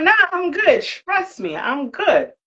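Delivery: high, breezy brush-off; dismissive. Retold or imitated. Brushing off her worried boyfriend, carefree high voice. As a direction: wave off his worry lightly.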